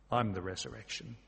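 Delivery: quiet solemnity. Retold or imitated. Jesus answers grieving Martha, quietly solemn. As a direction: drop low and soft for the weighty claim. Jesus's reply, repeated for weight.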